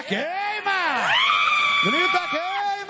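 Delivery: high, rousing preacher's shout; full-throated exorcism cry; forceful shout. Real, not acted. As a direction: yell it to fire up the congregation. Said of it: "Isso, grita forte".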